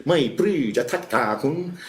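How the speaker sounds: put-on slow, high and loud in a thick southern Thai accent. The Thai doctor answering the injured patient in a thick southern Thai accent. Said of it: "in his very thick southern Thai accent".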